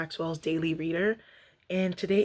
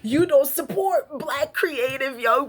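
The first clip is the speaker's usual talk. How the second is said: louder, slow, outraged voice; hammed-up angry ranting, much louder; mock indignant rant; 9 semitones higher, 9 dB louder, much slower than usual. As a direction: spit it out as a wronged rant. Imagined angry rumination.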